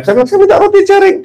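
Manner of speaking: squeaky high falsetto request; high mock voice. Preacher playfully asking his friend for roti jaring in a high voice. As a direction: pipe it up, a timid little asking voice. Voicing himself asking for bread.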